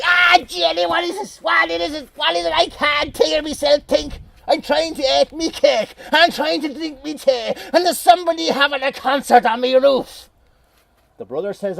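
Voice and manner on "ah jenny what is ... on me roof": cranky farmer shouting over the racket; exasperated Atty. Acted. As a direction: rant like a furious old farmer. Atty raging at fairy noise.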